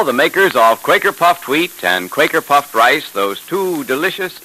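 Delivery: ordinary talk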